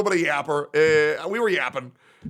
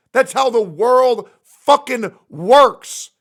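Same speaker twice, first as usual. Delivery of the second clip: slow, much louder, punching world; slow shouted conclusion; loud slow rant; 6 semitones higher, 9 dB louder, slower than usual. Real, not acted. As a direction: hammer it home louder. Emphatic ranting repeat.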